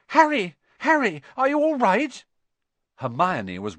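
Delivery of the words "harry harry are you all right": put-on high, loud girl's call; anxious calling. A narrator's reading. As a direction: call out urgently to a friend in the distance. Hermione calls out, running up.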